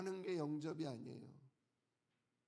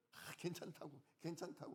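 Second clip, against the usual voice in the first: soft, offhand brush-off; lightly acted, hushed dismissal; mock easygoing reassurance; 8 dB quieter than usual. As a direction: wave it away quietly, imitating leniency. Mimics excusing what benefits oneself.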